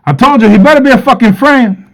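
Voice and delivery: high, loud, threatening dad; angry father. Retold or imitated. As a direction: bark it like a furious father. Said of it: "was like".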